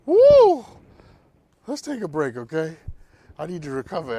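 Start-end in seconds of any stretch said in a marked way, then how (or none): 0.1-0.6 big high whoop of release. Real, not acted. Host whooping after a fired-up answer. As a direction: let out a loud joyful whoop. Exhilarated shout after preaching.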